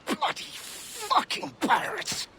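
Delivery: slow, high-pitched angry yell; high, strained outburst of rage; furious cursing. Acted. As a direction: curse them out, dragging each word. Cursing pirates in an acted clash.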